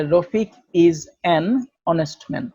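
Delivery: slow, with an clearly marked; slow teaching read leaning on an; stress on an. Shown as a demonstration. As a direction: slowly lean on an before honest. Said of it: "তার আগে আমি বলবো an".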